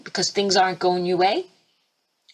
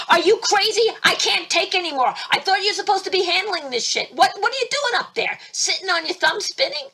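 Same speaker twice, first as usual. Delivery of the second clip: mock screaming rant; 10 semitones higher than usual. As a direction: yell at him like you've had enough. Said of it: "I could have been screaming at him".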